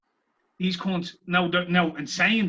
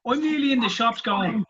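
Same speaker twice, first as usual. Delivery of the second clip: ordinary delivery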